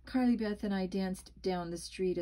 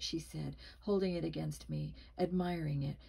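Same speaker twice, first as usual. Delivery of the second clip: ordinary delivery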